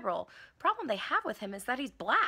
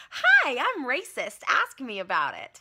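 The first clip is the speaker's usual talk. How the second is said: high, loud, slow sign-wearer voice; 3 semitones higher, 8 dB louder than usual. Acted. Sign on a birther, read in a loud, high chirp. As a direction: chirp it like a proud badge. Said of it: "wear a sign that says".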